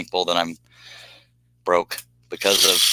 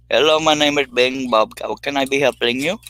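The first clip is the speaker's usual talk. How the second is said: mock Indian call-centre accent; 5 semitones higher than usual. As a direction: greet the caller like a cheery Indian support agent. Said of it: "y'all know how I like to do accents".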